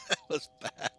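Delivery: high squeaky laugh breaking into words; giggling self-mockery; laughing at himself. Real, not acted. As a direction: crack up at your own bad joke. Laughing at his own pun.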